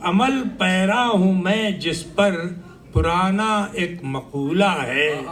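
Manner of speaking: poem recitation. Acted. Recites the closing couplets.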